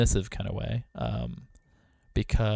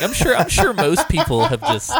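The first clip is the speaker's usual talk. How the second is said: laughing through the words, louder; retelling with a laugh, higher; 9 semitones higher, 5 dB louder than usual. Retold or imitated. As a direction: giggle while retelling your own doubt. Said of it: "I was like".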